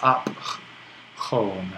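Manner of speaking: "ap, home" split by a pause, with a rough h; rough breathing. Shown as a demonstration. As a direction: puff out the p of "ap", pause, then "home". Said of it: "that kind of rough sound here".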